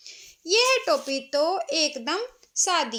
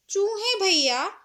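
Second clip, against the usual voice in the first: higher, louder pleading call; pleading tailor; 7 semitones higher, 5 dB louder than usual. Acted. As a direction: beg the mouse nervously. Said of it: "दर्जी ने कहा". (डरते हुए विनती करता दर्जी)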